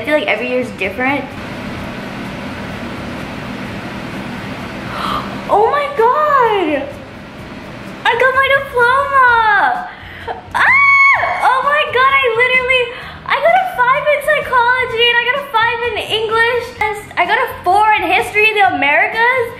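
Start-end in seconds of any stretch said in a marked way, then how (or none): 1.3-5.5 sharp shocked intake of breath; gasp. Real, not acted. Opening IB results, gasps. 5.6-19.5 much higher, half-laughing, half-crying shouts; overjoyed tearful outburst. Real, not acted. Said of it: "because I was crying".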